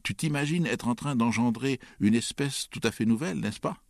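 high, needling insinuation; caustic, treacherous. A narrator's reading. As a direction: goad a confession out with mock sweetness. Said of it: "sur un ton de perfidie caustique".

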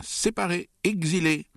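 high barked accusations; high-pitched, indignant bark. A narrator's reading. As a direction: bark each word as a verdict. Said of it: "aboya oncle Vania".

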